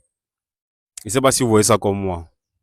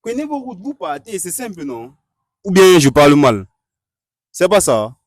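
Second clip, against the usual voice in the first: louder and higher, losing patience; exasperated outburst; 6 semitones higher, 7 dB louder than usual. Acted. Host fed up with the feuding couple, loud and exasperated. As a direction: tell them bluntly to go separate ways.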